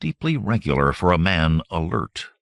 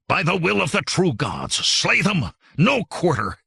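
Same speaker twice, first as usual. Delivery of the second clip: battle cry; 10 semitones higher than usual. A narrator's reading. Hillmen's war cry in battle.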